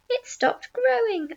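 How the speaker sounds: high, drawn-out exclamation; cried out. A narrator's reading. Aunt noticing the peach halt, high drawn-out cry. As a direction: cry it out slowly, pitched high. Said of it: "Aunt Spiker cried".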